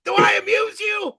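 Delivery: loud, high mock-aggressive outburst; Joe Pesci. Guest interrupting the host's anecdote, shouting as Pesci. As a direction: cut in with a shouted movie-gangster challenge. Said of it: "It's always Joe Pesci".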